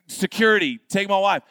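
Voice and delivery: mock-frantic loud order; calling security. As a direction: call out urgently for the guards. Retold frantic call to security.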